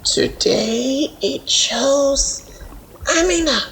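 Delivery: a slow, grave elder giving judgment; solemn elder. A narrator's reading. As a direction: pronounce it like ancient wisdom.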